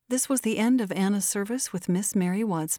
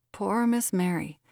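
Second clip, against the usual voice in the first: ordinary delivery, nothing marked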